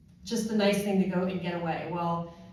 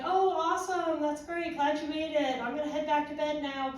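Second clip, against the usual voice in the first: high, breezy imitation of husband; cheery brush-off; 9 semitones higher than usual. Sleepy husband getting off the phone, cheery. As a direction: sign off happily in a raised pitch.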